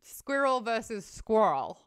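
squirrel first with two syllables, then as one; two squirrel versions. Shown as a demonstration. She contrasts Australian and American squirrel. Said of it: "one syllable word".

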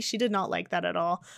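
ordinary talk

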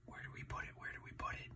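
hushed secret whisper. Acted. Uncle whispering a question to young listeners. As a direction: lean in and whisper to the kids.

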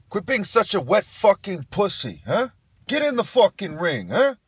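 loud, high trainer's yell; goading fight trainer. Acted. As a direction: bark at your fighter to get in the ring. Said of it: "the trainer for the opposition is saying".

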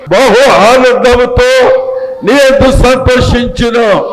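slow, high, drawn-out Bible reading; solemn pulpit reading with stretched phrases; scripture declamation. Acted. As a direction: proclaim each phrase of the verse slowly and high.

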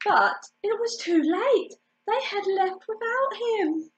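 usual speaking voice

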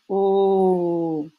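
"O" drawn out slowly on a steady note; long O. Shown as a demonstration. Teacher showing the long sound heard in bomen, drawn out. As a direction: hold the "O" for a long beat. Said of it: "Quand il y a un son long".

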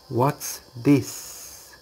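"What's this?" with a falling tune. Shown as a demonstration. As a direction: let "What's this?" go down at the end. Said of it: "questions goes down".

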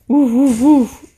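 put-on loud barking woof for a child; playful dog woof. Imitating dog for toddler.

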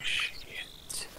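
hushed curse; whispering so deer won't hear. Real, not acted.

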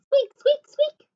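very high run of mousy squeaks; string of high-pitched squeaks; mouse squeaks. A narrator's reading. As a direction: squeak playfully like little mice. Children squeak together like mice.

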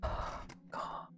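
ordinary delivery

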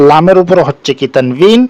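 ordinary talk

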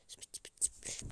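faint unvoiced whisper. Real, not acted. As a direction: barely breathe it. Fısıltı. (fısıltıyla)

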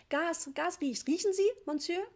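high-pitched urgent appeal; agitated. A narrator's reading. Mother urging the repairman to smell gas, agitated. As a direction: press the point, voice climbing.

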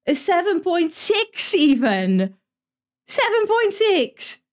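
high, slow, incredulous cry; outraged disbelief. Real, not acted. Streamer seeing the blundering goalie's high rating, outraged disbelief. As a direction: stretch it out high, climbing in indignation.

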